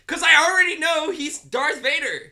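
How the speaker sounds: loud, high, drawn-out protest; exasperated shout. Real, not acted. Defending not watching the prequels, exasperated shout. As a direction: shout your point home, slowly and emphatically. Arguing he needn't watch the films.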